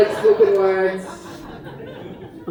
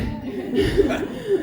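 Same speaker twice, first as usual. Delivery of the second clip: short teary laugh, high; embarrassed laugh; 9 semitones higher, 4 dB quieter than usual. Real, not acted. Performer laughs at her own tears mid-poem, teary giggle.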